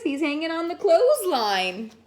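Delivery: plain reading voice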